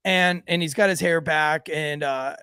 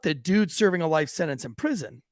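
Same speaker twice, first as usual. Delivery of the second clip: normal talking voice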